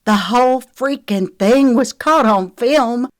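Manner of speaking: high, loud, frantic outburst; hysterical. A narrator's reading. Lexi points to the camera, hysterical. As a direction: blurt it out near hysteria. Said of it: "A hysterical laugh bubbled in Lexi's throat".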